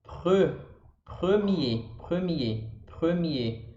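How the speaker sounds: "premier" as pruh-MYAY with throat R; rounded E in "Pre", silent final R in "premier"; French pronunciation. Shown as a demonstration. Teacher drilling examples for students, French rounded E. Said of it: "Quais são os exemplos?".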